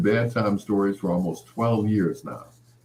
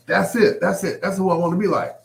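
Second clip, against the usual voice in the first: excited; 10 semitones higher, 5 dB louder than usual. Retold or imitated. Seeing his idol on screen.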